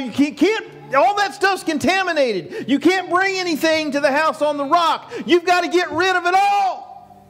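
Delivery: ordinary talk, nothing marked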